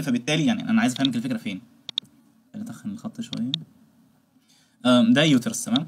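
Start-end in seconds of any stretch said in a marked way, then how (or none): 2.5-3.5 barely audible whisper to oneself; hushed working aside. Real, not acted. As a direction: mutter it under your breath, almost no voice. Muttering to self while drawing.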